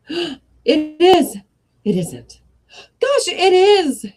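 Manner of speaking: high, loud excited cries; excited cry. Acted. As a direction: cry out in delight. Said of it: "he cried".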